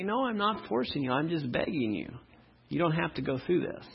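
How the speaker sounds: quiet, gentle begging; soft pleading. Acted. God softly begging the sinner to come. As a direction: plead softly, almost in a hush. God's reply in acted dialogue.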